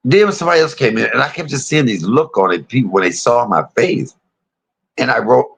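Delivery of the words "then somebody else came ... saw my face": ordinary talk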